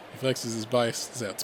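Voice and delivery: ordinary delivery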